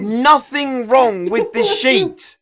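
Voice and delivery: loud exasperated. Real, not acted. GM yells at broken sheet.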